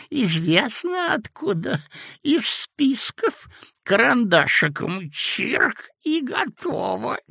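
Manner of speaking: put-on sweet-talking sinister old man; sly old man. A narrator's reading. Old clerk character voice. (вкрадчивый зловещий старичок)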